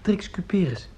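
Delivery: hushed whisper of her name. Acted. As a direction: breathe the name shyly. Confessing name to mother.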